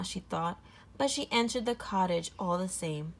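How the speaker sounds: usual speaking voice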